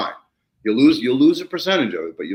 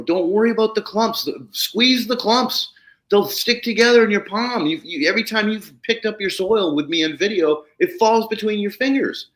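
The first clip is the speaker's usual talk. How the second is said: high-pitched, eager retelling of reassurance; excited reassurance; 11 semitones higher than usual. Retold or imitated. Telling a client not to worry about clumps, excited reassurance. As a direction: talk a worried client up, pitched well above your usual. Retold pep talk to worried client.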